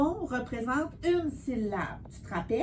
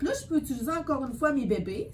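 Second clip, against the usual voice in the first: ordinary talk, nothing marked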